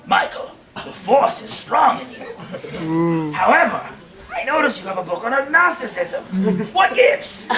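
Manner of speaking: lightly voiced sinister ruler, low at first; Pope Palpatine. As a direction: play a slightly hushed villain pontiff. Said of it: "Pope Palpatine looked over at him and said".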